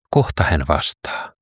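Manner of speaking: ordinary delivery, nothing marked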